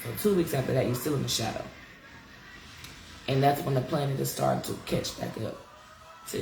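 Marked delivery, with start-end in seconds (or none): none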